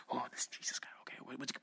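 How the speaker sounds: hushed excited whisper of a crowd. Retold or imitated. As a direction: breathe it like gossip in a crowd. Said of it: "they're talking like".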